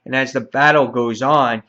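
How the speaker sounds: normal talking voice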